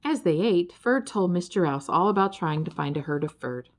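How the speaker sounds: usual speaking voice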